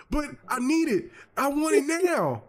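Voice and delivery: put-on high, slow begging kid voice; whiny child. Boy begging grandma for the Transformer, high whine. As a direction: whine for the toy like a little boy.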